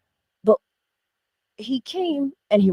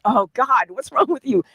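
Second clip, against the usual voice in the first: louder and higher in mock despair; exasperated outburst; 6 semitones higher, 11 dB louder than usual. Mother to her teasing son, loud exasperated. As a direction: throw up your hands at the kid. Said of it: "I'm like".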